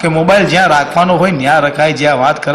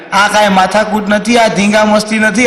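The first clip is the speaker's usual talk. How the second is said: raised pitch, building to a drawn-out final cry; declaimed punchline; 5 semitones higher, slower than usual. Acted. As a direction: build it up, then stretch the last phrase high. Couple shout punchline together.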